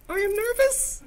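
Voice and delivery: squeaky-high pitched demo; nervous high voice. Shown as a demonstration. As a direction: jump way up in pitch to show nerves. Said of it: "in high voice".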